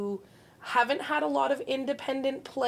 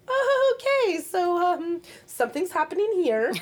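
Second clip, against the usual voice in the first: high startled oh, then uneasy; walking in on a fight, thrown; awkwardly startled friend; awkward surprise; 8 semitones higher, 4 dB louder than usual. Retold or imitated. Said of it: "you're like".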